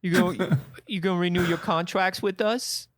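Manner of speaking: put-on cooing baby talk; baby talk to a client. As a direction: coo at him like a toddler. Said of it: "and is like".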